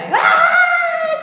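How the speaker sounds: sudden high shrieking "ay" with a laugh; imitated scream. Sister hearing mother died, mock high scream. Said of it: "Comenzó a gritar".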